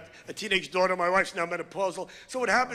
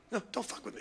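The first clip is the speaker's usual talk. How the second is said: soft mock warning, much softer; rattlesnake warning; 11 dB quieter than usual. Comic voicing a rattlesnake warning off, softly. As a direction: hiss it softly like a snake. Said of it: "They tell you".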